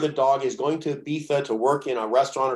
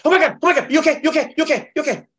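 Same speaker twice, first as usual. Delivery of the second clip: high, loud frantic fussing; panicked fuss; 12 semitones higher, 5 dB louder, much faster than usual. Retold or imitated. Chiropractor mocking a panicked colleague, high frantic fussing. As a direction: fret over a patient in a panic.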